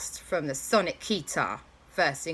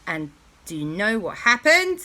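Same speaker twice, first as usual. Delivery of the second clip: usual speaking voice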